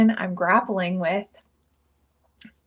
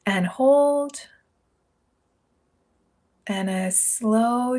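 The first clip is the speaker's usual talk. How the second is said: guided meditation; 2 semitones higher than usual. Acted. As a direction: guide listeners gently into each breath, unhurried, leaving room to inhale and exhale. Leads a guided breathing meditation.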